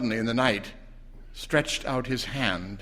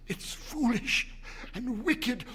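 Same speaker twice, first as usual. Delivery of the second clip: high, slow, soft protest; fearful protest; 13 semitones higher, 4 dB quieter, slower than usual. A narrator's reading. Husband protesting to his wife, high and fearful. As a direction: plead against it, afraid.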